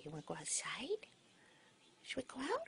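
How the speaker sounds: whispering. Real, not acted. Asking pet bird softly.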